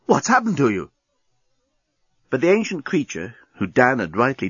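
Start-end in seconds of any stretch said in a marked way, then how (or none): 0.0-0.9 high-pitched shocked recognition; exclaiming. A narrator's reading. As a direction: blurt it out, aghast at the sight. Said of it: "exclaimed Dan".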